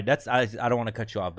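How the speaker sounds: normal talking voice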